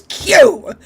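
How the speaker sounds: high, loud yell of the name; shouted name. Retold or imitated. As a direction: bark it out like an exasperated captain. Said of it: "He actually yells at him and goes".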